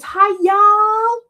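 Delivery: high, hammy Southern drawl; a bad try at a Southern "Hi, y'all"; Southern accent. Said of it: "I can't do her accent".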